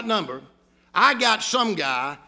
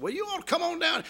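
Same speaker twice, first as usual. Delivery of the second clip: higher, laid-back Southern invitation; hammed-up Southern accent; 8 semitones higher, 5 dB quieter than usual. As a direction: lay the Southern accent on thick, pitched up. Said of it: "You sure do have a pretty Southern accent".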